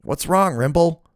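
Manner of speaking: high, worried henchman; high-pitched man. A narrator's reading. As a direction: squeak it out anxiously. Twig voiced in a story.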